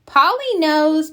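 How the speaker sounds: put-on high, drawn-out proud child. A narrator's reading. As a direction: announce it proudly, slowly. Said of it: "Peppa says proudly".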